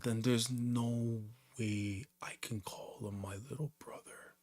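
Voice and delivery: slow, very soft and breathy whisper; fading into whisper. Acted. Son refusing his mother about little brother, whispering. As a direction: let the line sink away into a whisper. Reading a son's hurt line aloud.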